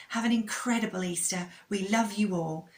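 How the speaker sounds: ordinary delivery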